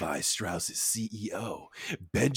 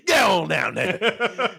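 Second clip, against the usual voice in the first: hammed-up slow shooing; 5 semitones higher, much slower than usual. Mock CEO drawn out.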